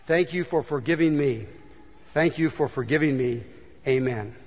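soft, hushed prayer voice; leading a quiet prayer of forgiveness. Acted. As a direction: pray it gently, leading them. Prayer continues softly.

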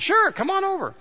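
doctor inviting the mechanic over; friendly doctor. Retold or imitated. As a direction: wave him over with an easy invite. Doctor voiced in the joke.

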